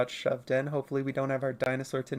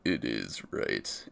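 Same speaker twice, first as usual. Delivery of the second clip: much lower, slow, flat correction; smug deadpan; 6 dB quieter, much slower than usual. Retold or imitated. Streamer retelling correcting people, low smug deadpan. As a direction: drop it low and slow, smugly certain. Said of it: "And then I go".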